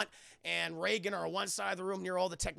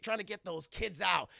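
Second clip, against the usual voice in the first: plain reading voice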